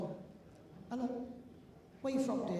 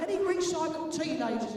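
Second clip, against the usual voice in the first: loud call-out; 6 semitones higher, 5 dB louder than usual. Real, not acted. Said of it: "we'll sing a song".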